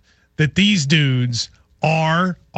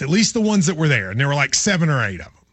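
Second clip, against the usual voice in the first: ordinary delivery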